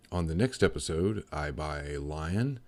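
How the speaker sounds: loud, booming trailer announcer; big, slow teaser voice; announcer outro. Acted. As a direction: sell the next episode like a TV promo.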